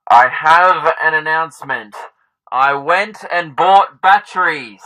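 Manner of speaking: booming megaphone announcement, slow; through a megaphone. Real, not acted. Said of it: "I've been using the megaphone".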